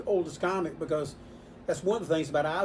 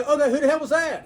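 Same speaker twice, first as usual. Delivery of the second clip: loud, high, exasperated question; imitated demand; 10 semitones higher, 9 dB louder than usual. Customer asks about the latest caller, loudly demanding. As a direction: blurt it out as the customer who must know.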